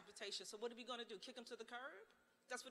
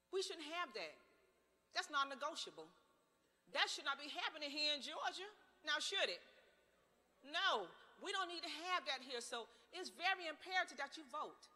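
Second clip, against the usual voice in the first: higher and louder, driving each point home; rising indignation; 6 semitones higher, 6 dB louder than usual. Real, not acted. Speaker rallying Georgia voters, loud indignant call. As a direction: let the outrage build, full voice.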